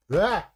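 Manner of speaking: short, high, loud yelp; sudden yelp of alarm; startled yelp. Real, not acted. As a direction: cry out as something slips from your hand.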